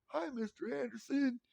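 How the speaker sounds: put-on high polite child's voice; kid greeting. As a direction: sing out hello like a small boy. Respectful kids greeting.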